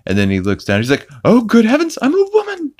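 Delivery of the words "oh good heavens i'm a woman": high falsetto mock alarm; Stewie. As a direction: exclaim in hammed-up cartoon horror. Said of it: "and Stewie freaks out".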